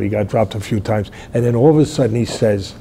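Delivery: ordinary delivery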